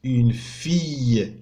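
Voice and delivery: Une fille said very slowly, syllable by syllable; teacher pronouncing for pupils; slow model word. Shown as a demonstration. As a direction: spell out Une fille with your voice. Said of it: "teacher models French words".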